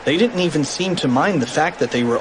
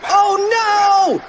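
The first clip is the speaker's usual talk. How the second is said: high-pitched alarmed shriek; hammed-up terrified yell; panicked cry; 4 dB louder than usual. A narrator's reading. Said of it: "cried Cheeseball".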